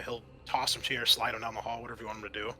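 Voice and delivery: ordinary talk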